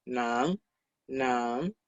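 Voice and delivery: "nan" starting low and rising; teacher's careful rising "nan"; second tone. Shown as a demonstration. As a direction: model "nan" softly, sweeping upward in pitch. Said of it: "en segundo tono".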